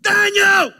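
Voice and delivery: calling Daniel in a high, loud shout. Shown as a demonstration. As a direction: yell his name out across the den. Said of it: "different than the way I'm gonna say it".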